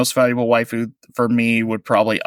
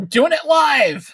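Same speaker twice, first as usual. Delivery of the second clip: much higher, shouted catchphrase; mock rant quote. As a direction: yell it like a live TV meltdown. Quoting famous rant.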